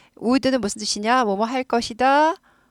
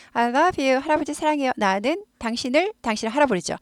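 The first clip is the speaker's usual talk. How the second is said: ordinary talk, nothing marked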